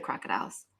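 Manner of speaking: ordinary delivery, nothing marked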